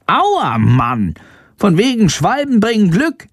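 high, irritated little bat voice; Shandor grumbling. A narrator's reading. Bat hiding from swallows, high annoyed grumble. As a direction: complain in a squeaky creature voice. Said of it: "zischt Shandor".

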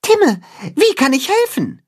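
lightly voiced mole; eager mole. A narrator's reading. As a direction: offer help eagerly. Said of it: "fragt er".

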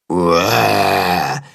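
low, drawn-out roar; copied monster roar. A narrator's reading. Willi repeats Tacho's roar to practise, low roaring. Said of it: "wiederholt Willi".

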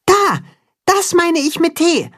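high excited little voice; high-pitched young Max; high child voice. Acted. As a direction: voice Max small and eager. Max's voice in the story.

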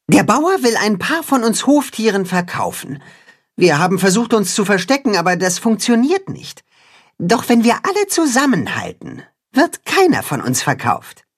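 high, earnest rallying little hen; high cat-hen voice. A narrator's reading. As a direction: lift the pitch, encourage the group. Katzenhuhn speaks to animals.

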